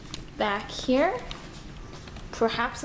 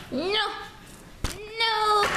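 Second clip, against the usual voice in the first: teasing high refusal; 10 semitones higher than usual. Pretend.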